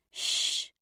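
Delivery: unvoiced "shh" for silence; shushing. A narrator's reading. Bridget hushes her friend to listen, shushing. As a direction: hush her with a long shh. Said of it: "put her finger to her lips".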